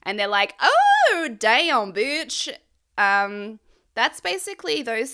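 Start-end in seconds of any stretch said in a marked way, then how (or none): 0.6-2.5 big high yelp of lust, then drawled; mock the lads ogling her; hammed-up lads' catcall; excited boys. Boys react to Lydia twerking.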